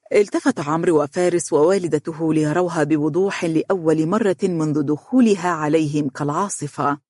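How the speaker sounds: plain reading voice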